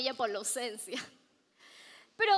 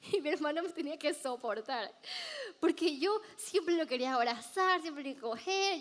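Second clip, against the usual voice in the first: ordinary delivery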